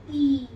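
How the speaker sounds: "ई" held as a long vowel; long E sound. Shown as a demonstration. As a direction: stretch out "ई" on its own. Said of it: "इसके भी दो sound होते हैं". ("ई" को लंबा खींचकर)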